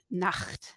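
"Nacht" with a throaty German ch; German pronunciation. Shown as a demonstration. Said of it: "some words in German".

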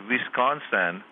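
Wisconsin with a put-on Iranian accent, V for W; comic attempt at an Iranian accent. Shown as a demonstration. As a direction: say Wisconsin as an Iranian might, opening on a V. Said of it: "an Iranian might say".

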